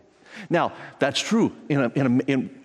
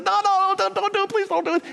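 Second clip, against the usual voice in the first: high-pitched, hammed-up begging; frantic mock plea, pitched way up; mock pleading. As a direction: play a servant begging in a high voice. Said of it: "the guy pleads and says".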